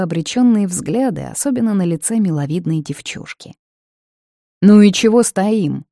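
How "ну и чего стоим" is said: loud, sharp drill-sergeant bark; barked shout. Acted. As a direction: bark it at the recruits. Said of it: "гаркнул Родерик".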